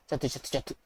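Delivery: low, very soft repeated mutter; hushed mutter. Acted. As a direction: mutter it under your breath. Muttering after killing in game. (പതിഞ്ഞ സ്വരത്തിൽ)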